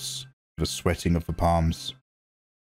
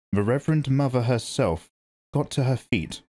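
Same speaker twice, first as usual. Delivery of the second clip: plain reading voice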